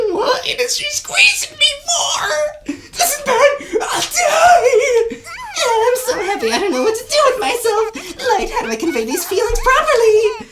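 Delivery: high, loud, laughing cries; overjoyed squeal. Acted. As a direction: gush with joy that can't be held in. Squeezed, overjoyed.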